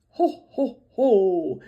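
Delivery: a big hearty Santa laugh; jolly Santa. Acted. Santa announcing his return to the reindeer.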